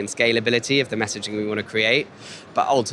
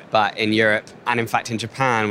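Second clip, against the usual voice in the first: normal talking voice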